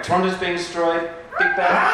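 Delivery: rallying the audience to scream; screaming reprise. Real, not acted. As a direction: shout it out for the crowd. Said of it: "Can we have a reprise on the screaming".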